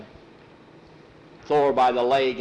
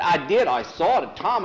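higher, louder protest; excited insisting; 6 semitones higher, 5 dB louder than usual. Acted. Cowhand to disbelieving Tom, excited insistence. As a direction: insist you really saw it.

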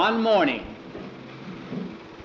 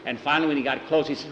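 ordinary delivery

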